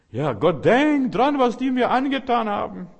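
mock the self-pitying believer nagging God; resentful whiner. Preacher parodies a grudge-holding believer whining to God.

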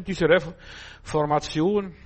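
ordinary delivery

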